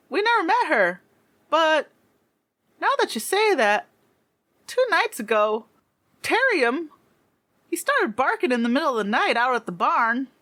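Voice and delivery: put-on high-pitched child recounting events; farm kid. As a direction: answer dad like a country youngster. Game master voices farm kid answering.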